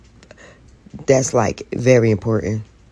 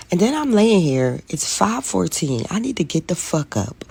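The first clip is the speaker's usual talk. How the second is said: ordinary delivery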